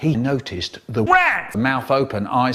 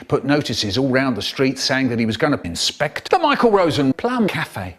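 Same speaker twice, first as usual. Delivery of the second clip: plain reading voice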